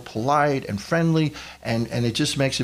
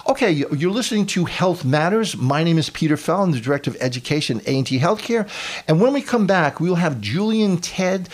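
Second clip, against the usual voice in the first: radio host announcer; 4 semitones higher than usual. Acted.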